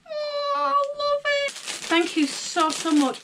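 real gift-opening gush; gushing delight. Real, not acted. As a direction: swoon over the gift. Gushing over unbought gift.

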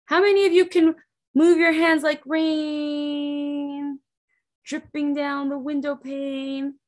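ordinary delivery

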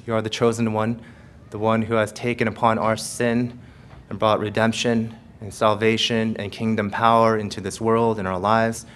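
low, soft, reverent prayer voice; hushed closing prayer. Acted. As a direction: pray quietly with heads bowed.

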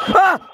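sudden high scream; real high startled scream. Real, not acted. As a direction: shriek at the jump scare. Jump scare.

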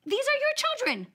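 wife lecturing husband on shared parenting; exasperated lecture. Pretend. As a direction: drive it home to him, mock outraged. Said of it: "I would have turned to my husband and said".

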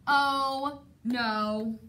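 usual speaking voice

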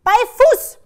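high, very loud commands; shouting. A narrator's reading. Grandma orders the runaway dog back, shouted commands. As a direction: yell urgent orders at a dog. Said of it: "Sie schreit".

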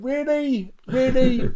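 high, desperate whimper; desperate disbelief. Retold or imitated. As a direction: plead up to heaven. Desperate plea to God.

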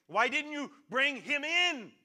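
loud, high, slow shouted question; angry demand. Acted. Authorities to the officer who came back empty-handed, angry shout. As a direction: stretch out an outraged demand at full voice.

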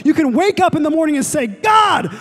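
full-force preacher shout; preaching shout. Acted. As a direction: cry it out to the room.

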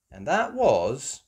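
plain reading voice

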